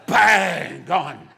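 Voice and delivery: loud, explosive burst; sudden bang. Acted. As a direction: fire it out to startle. Mimics bubble popping without warning.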